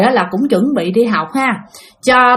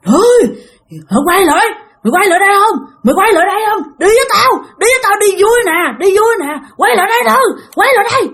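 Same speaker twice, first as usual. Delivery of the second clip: high, loud harsh demand; harsh growled command; 10 semitones higher, 3 dB louder, faster than usual. Retold or imitated. Ghost woman chasing the girl, harsh loud demand. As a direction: snarl at her to come back. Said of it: "bà gằn cái giọng".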